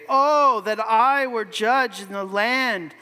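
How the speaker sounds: a prince sighing to be judge, slowed; yearning Absalom. Acted. Absalom's longing speech acted.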